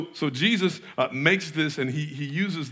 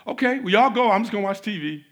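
mock casual guest; 6 semitones higher, 5 dB louder than usual. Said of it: "And you're like".

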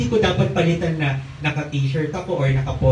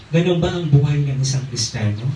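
usual speaking voice

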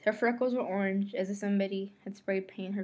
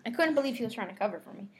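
ordinary delivery, nothing marked

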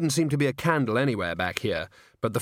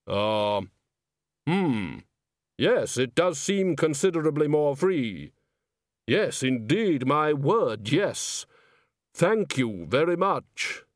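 grim reaper voice; 2 semitones higher, slower than usual. Acted. Death voiced in story.

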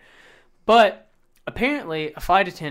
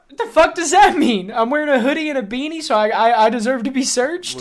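high, louder incredulous protest; offended, pitched-up rant; indignant outburst; 10 semitones higher, 8 dB louder than usual. Retold or imitated. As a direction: protest it like you're outraged and baffled. Indignant replay of reaction to mom.

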